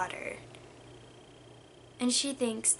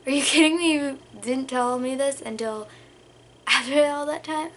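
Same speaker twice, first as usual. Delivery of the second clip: raised, incredulous mock protest; retold indignation; 3 semitones higher, 5 dB louder than usual. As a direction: act out being shocked at your mom. Retells confronting mom in disbelief.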